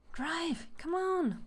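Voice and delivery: high, loud, urgent demand; frantic passenger shout. Acted. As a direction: yell at the driver to hurry.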